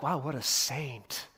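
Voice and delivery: a hushed, slow mock "wow"; teasing mock wonder, slow and low; mock awe. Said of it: "Pilate isn't like".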